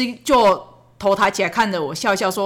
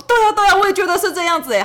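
eager agreement; 9 semitones higher, 3 dB louder, faster than usual. Retold or imitated. Mother eagerly agreeing with son.

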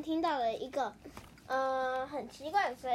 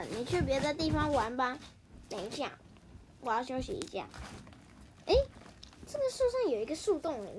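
ordinary delivery

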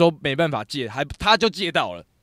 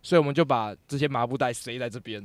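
usual speaking voice

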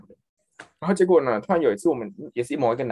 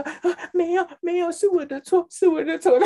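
much higher, timid apologizing voice; meek apology; 3 dB louder, slower than usual. Retold or imitated. Scared trainee apologizes to the angry supervisor, high and timid. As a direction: play the scared trainee saying sorry.

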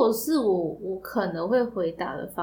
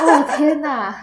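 slow, amused "oh my god"; laughing in disbelief; 5 semitones higher, 9 dB louder, slower than usual. Real, not acted. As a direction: laugh it out, stunned. 听到好笑回答. (边笑边惊叹)

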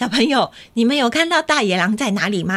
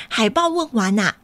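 ordinary delivery

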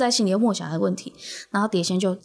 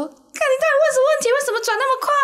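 high, loud terrified shriek, stress on 问; panicked yell; 4 dB louder, faster than usual. Retold or imitated. Terrified friends yelling as the plate spins faster, loud and shrill.

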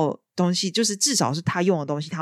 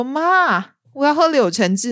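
mock spoiled demand; 9 semitones higher, 7 dB louder, slower than usual. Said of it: "我又在家里说".

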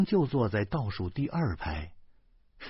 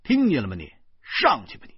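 sneering cop; 8 semitones higher, 3 dB louder, faster than usual. A narrator's reading. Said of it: "警察再次冷笑".

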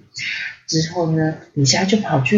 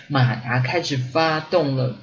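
usual speaking voice

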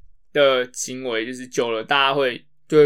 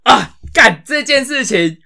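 loud, high burst as it hits him; sudden outburst; 7 semitones higher, 7 dB louder, slower than usual. Real, not acted. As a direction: blurt it out when the memory hits. (突然想起，高声爆出一句)

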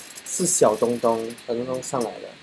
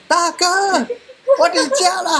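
drawn-out, much higher shout of 大哥; retold call to the driver from the window, slow and much higher; much slower than usual. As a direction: slowly holler it across the street like calling from a window. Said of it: "然后我就又大喊".

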